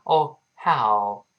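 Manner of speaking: ordinary talk, nothing marked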